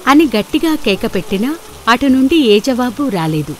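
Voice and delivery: ordinary delivery, nothing marked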